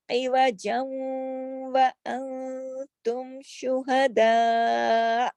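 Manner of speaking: very slow drawn tajweed chant; recite, stretching each vowel; chanted verse ending on a stop; Quran recitation. Shown as a demonstration.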